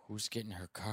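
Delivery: low, hushed South Side Irish Chicago uncle; South Side Irish Chicago accent. Retold or imitated. Uncle after a death eyeing the car, South Side Irish Chicago accent. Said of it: "He's got the South Side Irish Chicago accent".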